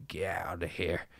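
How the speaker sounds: shaky unvoiced whisper; trembling whisper. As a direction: breathe it out in terror. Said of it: "as a trembling whisper".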